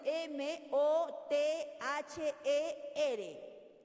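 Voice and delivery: very slow, each letter spelled out in Spanish. Shown as a demonstration. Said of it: "y le pregunto, a ver qué dice". (deletreo lento, letra por letra)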